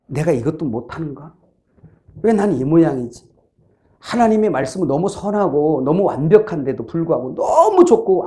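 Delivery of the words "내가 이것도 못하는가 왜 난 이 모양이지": low, subdued mock self-blame; mild self-reproach. Believer under the law blames himself inwardly, mild self-reproach. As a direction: quietly voice someone doubting themselves. Voicing a self-abusing inner monologue.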